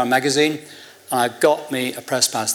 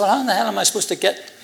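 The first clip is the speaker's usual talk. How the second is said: higher, quicker mock exasperation; exasperated retort; 8 semitones higher, faster than usual. Asking officials how he is supposed to get in, mock exasperated. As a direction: retell being at your wit's end, higher pitched.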